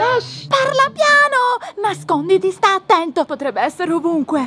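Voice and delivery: high, loud, urgent hushing; frantic warning. Acted. Girl tells friend to hide from monster, frantic warning. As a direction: shush him in a loud, high panic.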